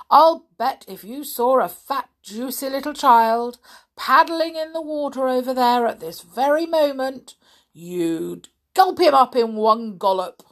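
big bragging croc, loud and high; greedy enormous crocodile. Acted. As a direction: gloat like a hungry crocodile. Story figure voice.

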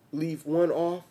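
ordinary delivery

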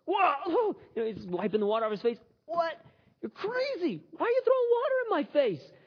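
high, outraged squawk; shocked bartender. Acted. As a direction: cry out, soaked again. Bartender splashed again.

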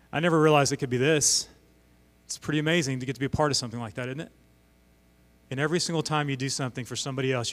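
normal talking voice

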